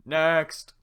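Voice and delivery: loud, high bark; head guard. Acted. Head guard calls the next in line, loud bark. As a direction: call the next one. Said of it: "head guard".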